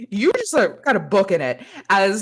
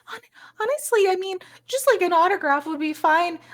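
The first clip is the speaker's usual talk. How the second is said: high-pitched, stammering fan voice; starstruck fan; 10 semitones higher than usual. Acted. Fan asks the idol for an autograph, high starstruck stammer. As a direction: gush shyly in a much higher voice.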